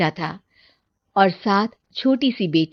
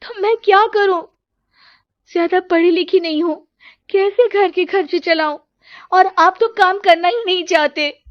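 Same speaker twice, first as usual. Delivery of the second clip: high, raised, exasperated retort; desperate complaint; 9 semitones higher, 4 dB louder than usual. Acted. Wife arguing with husband about money, high and upset. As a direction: throw the blame back at him. Roli argues back with husband.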